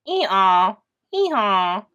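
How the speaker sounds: loud braying ee-haw; loud donkey hee-haw. Acted. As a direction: bray it like a donkey. Reader voices donkey braying.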